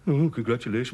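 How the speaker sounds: sarcastic, dragged-down imitation; mocking echo. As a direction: parrot back the half-hearted congratulations. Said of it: "you could smile a bit".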